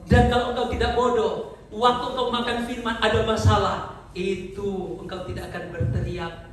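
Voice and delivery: normal talking voice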